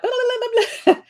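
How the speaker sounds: high, silly blah-blah brushing it off; playful mock gibberish. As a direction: wave it away in a goofy high voice. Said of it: "I was just like".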